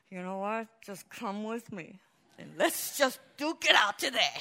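half-joking challenge to a fight; mock fed-up pastor. Pastor imagining telling a congregant to duke it out, mock tough. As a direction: playfully pick a fight. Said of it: "Perhaps sometimes I'll be like".

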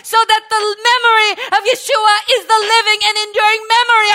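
loud, high, rushing praise; preaching at full force. Real, not acted. Preacher to congregation, rising to full-force praise. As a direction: build and erupt into hallelujah.

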